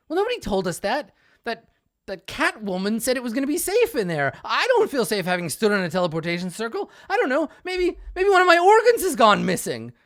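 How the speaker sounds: hammed-up worry about missing organs; rising mock panic. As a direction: build from fretting to near-shrieking alarm. Sembra panics about teleport.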